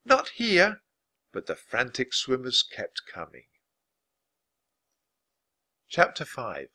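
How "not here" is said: high, urgent yell; high-pitched alarm; warning shout. A narrator's reading. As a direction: call it out to stop them. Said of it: "she shouted".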